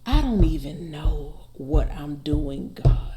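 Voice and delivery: drawn out slowly, savoring each word. Shown as a demonstration. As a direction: linger on every word as if weighing it. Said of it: "It's so much in that sentence".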